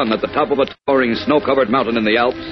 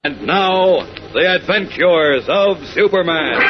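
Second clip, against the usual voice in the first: slow grand title announcement; announcer; 6 semitones higher, 4 dB louder, slower than usual. Acted.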